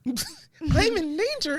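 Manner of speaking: very high, drawn-out playful mimicry; mock echo. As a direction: echo it slowly in a silly high voice. Mimicking co-host's line while laughing.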